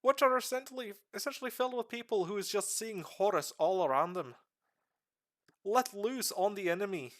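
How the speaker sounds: rough put-on Irish accent held through a lore talk; streamer's half-Irish accent. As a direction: explain the lore in a loose, slipping Irish accent. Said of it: "I'm not even sure what kind of accent I'm doing right now".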